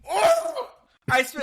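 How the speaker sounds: a sudden very high, loud yelp; squealing indignant gasp; outraged shriek. Real, not acted. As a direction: shriek in mock offence at the jab.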